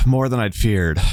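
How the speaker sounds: tired, out-of-breath line after a sigh; the knight reports grimly to the troops; weary commander. Acted. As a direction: let the relief and worry sit in it. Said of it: "She's like".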